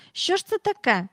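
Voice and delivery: high-pitched worried question; alarmed. A narrator's reading. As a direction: ask it in startled alarm. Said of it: "стривожився навіть безжурний Майкл".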